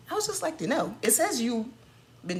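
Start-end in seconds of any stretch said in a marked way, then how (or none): 0.1-1.0 higher, sing-song fake politeness; a higher mock-polite retake; mock sweet asking. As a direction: ask it sweetly, all fake innocence.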